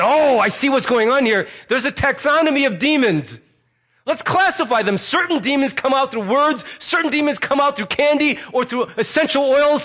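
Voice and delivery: high, eager know-it-all; mock discovery. Misguided readers classifying demons, mock-enthusiastic.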